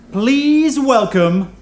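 loud, drawn-out introduction; announcer shout. Real, not acted. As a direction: call the name to the back row. Host introduces player to crowd.

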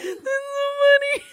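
high, squealing laugh-talk; real hysterics, very high voice; cracking up. Real, not acted. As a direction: squeak it out through helpless laughter. Cracking up at the meme.

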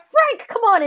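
high mock holler to a straggler; urgent calling. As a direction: imitate calling a friend from the car. Retold calling from the car.

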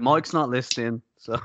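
ordinary talk, nothing marked

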